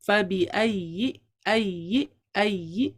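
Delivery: slow recitation, اَيِّ said softly without the teeth. Shown as a demonstration. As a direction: recite اَيِّ gently and unhurried. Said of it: "softly پڑھا جائے گا". (نرمی سے آہستہ تلاوت)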